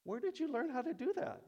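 very high, loud sing-song praise; doting mom. Acted. Mom praising her child for the gift, high doting coo. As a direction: coo over the child in delight.

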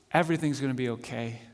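low, soft and quick reassurance; hushed relief. Acted. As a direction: let the relief spill out softly. Mary's relief, softly.

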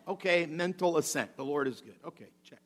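quiet, offhand box-ticking; muttered, perfunctory agreement; mere mental assent. Acted. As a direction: tick it off without any feeling. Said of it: "a mental assent".

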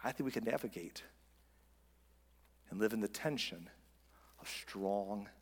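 slow, low, hushed preaching; solemn sermon close. Real, not acted. Pastor urging congregation to give grace, quietly solemn. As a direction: bring it down to a soft, weighty appeal with long pauses.

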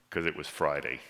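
low and very soft, sinking away; somber, hushed. Real, not acted. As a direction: close the thought in bleak quiet. Somber close on Peter's dark Friday.

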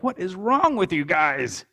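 slow, mock exasperated outburst at the kids; a mild imitation of losing patience, drawn out; retold frustration. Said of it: "and I got so frustrated".

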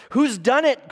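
high, loud, urgent question; Susan crying out. A narrator's reading. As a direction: read it as an alarmed cry. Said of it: "cried Susan".

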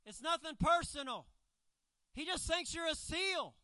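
high, loud call across the water; yelled reassurance. Acted. Someone on a boat yells to a swimmer attacked by a shark. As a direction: holler it out as if from afar. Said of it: "someone yells out".

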